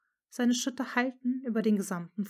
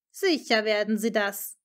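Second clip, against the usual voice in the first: mocking; 8 dB louder than usual. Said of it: "zischte er spöttisch".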